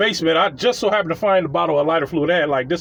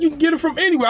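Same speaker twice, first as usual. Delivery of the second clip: exasperated; 9 semitones higher, much faster than usual. Retold or imitated. Annoyed answer.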